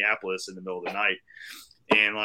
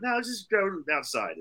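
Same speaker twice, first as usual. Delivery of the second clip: deflecting; 10 semitones higher than usual. Retold or imitated. Putting off a stranger wanting his room, high-pitched deflection. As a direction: wave the idea away, voice up high. Retold deflecting her invitation.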